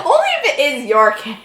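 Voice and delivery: ordinary delivery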